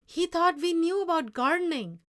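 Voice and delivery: loud, high reproach; angry. Acted. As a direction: rebuke the boy sharply. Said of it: "His Spanish was fast and angry".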